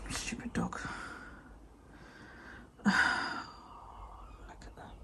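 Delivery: whispered exasperated aside; hushed complaint about the dog; fond whisper. Real, not acted. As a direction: whisper it with a sigh.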